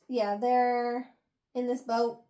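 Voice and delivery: ordinary talk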